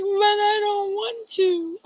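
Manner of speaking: whiny protest. Retold or imitated. Retelling crying over poem.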